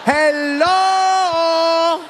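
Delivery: high, drawn-out hello; bartender's warning. Retold or imitated. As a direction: call out a long warning, imitating the bartender. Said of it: "the bartender is trying to warn me".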